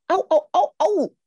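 high, loud volley of ouches; cried out. A narrator's reading. Bitten grandparents call out, high loud yelps. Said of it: "riefen seine Großeltern".

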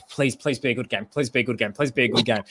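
low, hushed pleading on repeat; quiet pleading. Retold or imitated. Fan silently willing an A-League game, quiet pleading. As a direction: plead softly under your breath, like a little prayer.

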